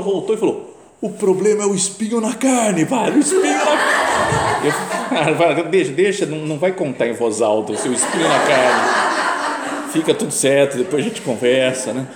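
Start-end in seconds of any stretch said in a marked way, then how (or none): 1.0-4.7 high, loud yell stretched out at the end; imitated distant shout. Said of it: "lá de longe ele parou". 5.2-12.1 loud, laughing attempt to hush someone; flustered placating. Hushing the man shouting his secret.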